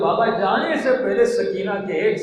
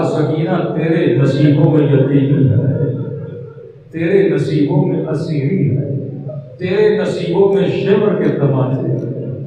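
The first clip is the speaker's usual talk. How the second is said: louder, wailing mournful cry; tearful lament; 8 dB louder than usual. Retold or imitated. Father mourning his daughter's fate, a loud tearful wail. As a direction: cry the words out over the child. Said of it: "اور رو کر کہا".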